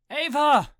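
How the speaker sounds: high, loud yell of a name. A narrator's reading. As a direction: yell to someone behind a door. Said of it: "I yelled".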